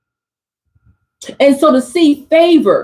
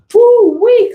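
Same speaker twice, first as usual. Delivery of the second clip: higher, louder "woo-wee"; excited whoop; 8 semitones higher, 4 dB louder than usual. Real, not acted. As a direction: hype up the reading. Hyped reaction to a card.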